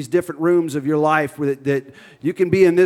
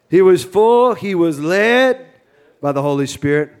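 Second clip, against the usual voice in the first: loud, high Cajun accent; hammed-up Cajun preacher voice; 4 semitones higher, 7 dB louder than usual. Shown as a demonstration. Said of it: "I'm trying to shake my Cajun accent".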